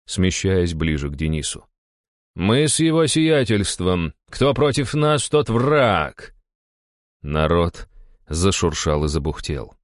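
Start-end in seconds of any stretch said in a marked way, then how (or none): 2.3-6.3 slow, raised, cold proclamation; icy declaration. A narrator's reading. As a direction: state allegiance coldly and deliberately. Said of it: "ледяным голосом проговорил лысый". (ледяное заявление)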